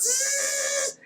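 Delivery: high hissing spray noise; spraying hiss sound. Retold or imitated. Sphere emptying his skull, spray hiss. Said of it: "It just goes".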